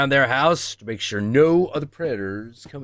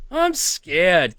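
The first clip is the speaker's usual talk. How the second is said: a touch of pretend fear, a bit higher; mock scared; 6 semitones higher, 4 dB louder than usual. Joking after the creepy raven clip, mild mock fear. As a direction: say it like a joke fright, no laugh now.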